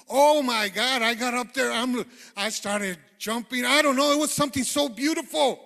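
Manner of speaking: loud, elated shouting; joyful outburst. Retold or imitated. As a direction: burst out with overwhelming joy. Overjoyed outburst recalling the peace.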